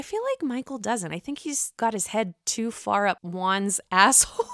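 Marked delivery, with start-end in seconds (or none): none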